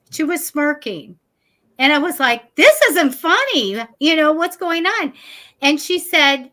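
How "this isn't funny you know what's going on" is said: upset protest. Retold or imitated. Retold upset at smirking lady.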